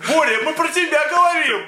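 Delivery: high, loud joking call. Pretend. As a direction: shout it across the room, teasing. Mock call-out to Borya.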